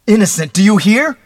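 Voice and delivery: high, loud desperate shout; overwrought, full-voiced protest. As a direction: yell it as nerves give way. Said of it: "he shouted".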